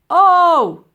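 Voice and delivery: high loud pained cry; yelp. Acted. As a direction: cry out at the blow. Professor hit again.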